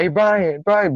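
high-pitched imitation of yelling to a friend; retold calling out. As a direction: call to Brian up ahead, not really shouting. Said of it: "So I yelled at Brian".

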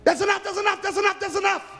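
very high shouted plea; crying enough. Acted. The overfed people begging God, high shouted plea. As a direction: yell it as if stuffed full.

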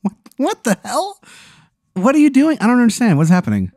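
high, rattled questions; panicked confusion. Acted. Bartender baffled by the new boss, panicked. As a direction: babble in alarm.

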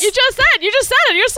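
high-pitched outraged exclaim; indignant high squeal. Retold or imitated. Mock indignant Elaine reply.

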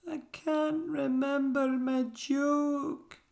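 very high, slow little wail; squeaky wail. A narrator's reading. Pickle forgetting the joke, high squeaky wail.

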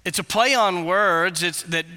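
ordinary talk

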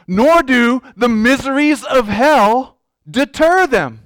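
slow, weighty pulpit reading; emphatic quote reading. Acted. Preacher reading a Scottish preacher's words to church, slow and emphatic. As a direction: declaim it slowly, phrase by phrase.

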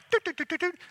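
high-pitched little dialing beeps; quick high "doot" noises; imitated phone dialing. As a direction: mimic keypad tones, doot by doot.

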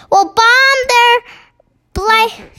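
high, slow, emphatic shout; military shout. A narrator's reading. Military man tells the Queen his plan, shouting high. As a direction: shout it high, pushing each word. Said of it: "shouted the head of the army".

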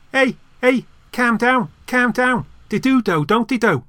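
high, loud comic Scouse; Scouse accent. Acted. Auditioning actor shows his second northern accent, exaggerated Scouse. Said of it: "Yorkshire or Scouse".

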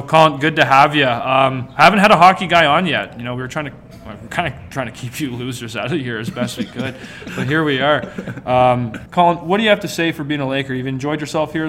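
ordinary delivery

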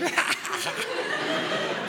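high, voiceless giggle; imitated kid cackling from the back seat; squeaky mock giggle; little brother's laugh. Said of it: "brother's in the back just like".

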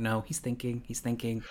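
whispered to herself; hushed. Retold or imitated. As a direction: shush yourself under your breath. Telling herself to stay quiet.

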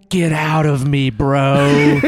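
slow, drawn-out haunted moan; ghostly house voice. Pretend. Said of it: "the ghostly voice from the house goes".

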